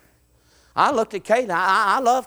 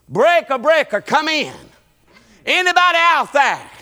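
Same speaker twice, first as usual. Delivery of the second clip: police radio call; 7 semitones higher, 5 dB louder than usual. Acted. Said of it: "Let me get on the radio".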